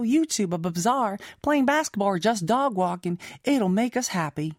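put-on reading a fan message in a light Southern accent; slight Southern accent. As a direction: give it a hint of a Southern American twang. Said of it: "I gave you, like, a slight Southern accent".

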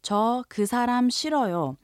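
저 그 사람 싫어요 said fast, run together; 자연스러운 속도로; natural speed. Shown as a demonstration. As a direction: say 저 그 사람 싫어요 as a native would. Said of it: "So if you say".

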